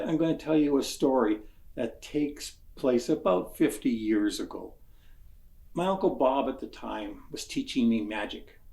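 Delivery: ordinary talk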